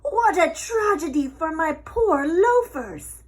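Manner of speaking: high, loud dramatic lament; wailing pig. A narrator's reading. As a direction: moan over his shoes like a ham. Pig wails over shoes.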